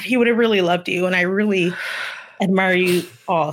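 ordinary talk, nothing marked

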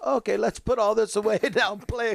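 a cheery teacher ditching the lesson for fun; playful quote. Retold or imitated. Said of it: "he goes".

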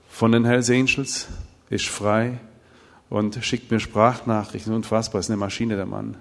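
ordinary talk

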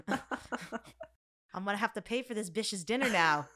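slow, put-out grumble in jest; hammed-up exasperation; mock complaint. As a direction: complain jokingly, stretched out. Jokingly complains about paying.

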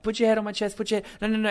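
rapid-fire imitation of stage directions; imitated instructing. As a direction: urgently repeat it, imitating a bossy director. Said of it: "hear her instructing him".

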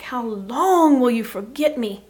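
David crying out to God in a loud, pained plea. Retold or imitated. A psalmist calling out to God, loud and aching. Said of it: "and he's like".

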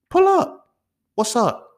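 high-pitched fight invitation; goading challenge. Acted. Daring classmate to fight off campus, goading. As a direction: goad him, voice leaping way up.

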